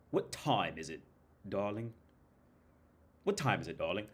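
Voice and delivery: lightly imitated London accent; British accent. Shown as a demonstration. Vlogger showing off his mimicry, imitated British accent. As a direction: try out a posh-to-street British voice. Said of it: "if I were to talk like this".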